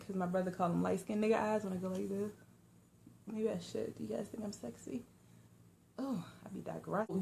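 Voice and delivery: quiet aside. Real, not acted. Vlogger digressing to viewers about her glasses, quiet aside. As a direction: drop it down as a throwaway aside.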